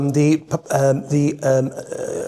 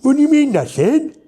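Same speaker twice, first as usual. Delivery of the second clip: higher, louder, laughing puzzlement; comic car advert, baffled customer voice; puzzled advert voice; 11 semitones higher, 4 dB louder than usual. Shown as a demonstration. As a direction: ask it amazed, half laughing. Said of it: "the advert started like this".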